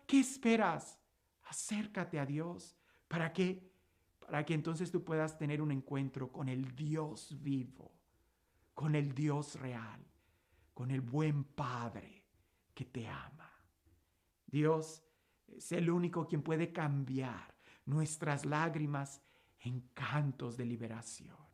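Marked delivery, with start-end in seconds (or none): 1.4-2.8 "Acércate a Dios" dropped much softer and slow; hushed plea. Acted. Repeated plea, second one hushed. 3.1-21.5 soft, slow and low, nearly whispered at the end; intimate hush. Acted. Closing intimate sermon passage.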